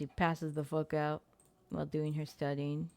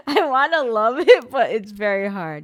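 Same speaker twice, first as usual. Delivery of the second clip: exasperated; 7 semitones higher, 14 dB louder than usual. Real, not acted. Exasperated at silly game.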